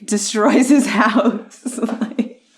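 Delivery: talking through a laugh; amused, words giving way to laughs. Real, not acted. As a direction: break into laughter while telling it. Amused retelling of Pooh's destruction.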